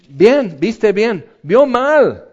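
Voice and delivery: God teasing the prophet with a wink; hammed-up divine voice, slow and high; playful mock God. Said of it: "Y Dios dice".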